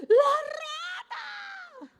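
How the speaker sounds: chillido muy agudo y alargado; grito de espanto imitado. Retold or imitated. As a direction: chilla de pánico, estirando las vocales. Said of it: "Y todos gritando". (grito agudo y largo, actuado)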